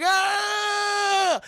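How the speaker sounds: long, high, loud mock shriek; drawn-out cartoon scream; monster scream. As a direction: imitate a shapeshifting monster. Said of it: "transforming all these different monsters".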